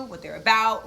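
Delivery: normal talking voice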